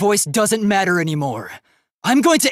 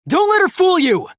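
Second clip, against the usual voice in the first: much higher, loud warning; urgent shout; 12 semitones higher, 4 dB louder than usual. Acted. Robot warning classmates about the mastermind, urgent shout. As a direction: cry out to stop them being fooled.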